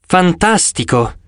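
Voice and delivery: Percy answers the goddess, forced polite; trying not to sound rude. Acted. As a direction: hide the disappointment. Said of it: "cercando di non sembrare sgarbato".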